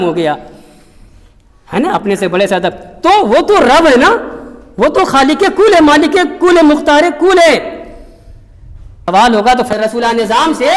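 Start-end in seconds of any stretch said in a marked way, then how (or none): 3.0-7.8 fervent preaching. Acted. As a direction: build it with reverence and force. Preaching Allah's greatness.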